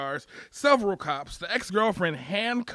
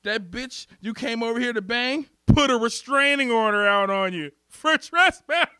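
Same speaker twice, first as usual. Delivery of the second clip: loud, higher, building toward laughter; hammed-up gleeful police taunt; gloating cop; 5 semitones higher, 7 dB louder than usual. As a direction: crow over him, louder and louder.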